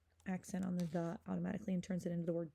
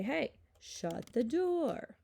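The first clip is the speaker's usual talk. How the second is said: slow, raised-pitch example command; drawn-out demonstration order with a higher voice; 5 semitones higher, 3 dB louder, much slower than usual. Shown as a demonstration. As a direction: call out an order, stretching each word. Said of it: "make it into the command form".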